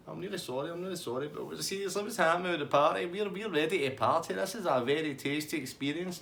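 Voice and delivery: high-pitched pleading party guy; Tasty Dave. Retold or imitated. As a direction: apologise then coax, in an imitated high voice. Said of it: "He's like".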